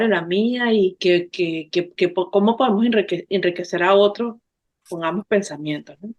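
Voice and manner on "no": usual speaking voice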